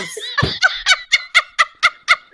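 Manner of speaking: real burst of high loud laughter. Real, not acted. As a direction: crack up helplessly at the joke. Cracking up at husband's joke.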